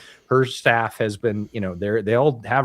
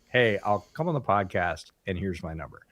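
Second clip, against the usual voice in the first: matter-of-fact, softer sincere commitment; genuine offer; 2 semitones lower, 5 dB quieter than usual. Acted. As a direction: say it plainly and mean it, dropping off at the end. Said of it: "as opposed to".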